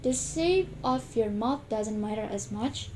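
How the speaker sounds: normal talking voice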